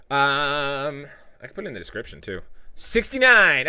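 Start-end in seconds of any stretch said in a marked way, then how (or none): 2.8-3.7 gleeful. Real, not acted. Reading the number gleefully.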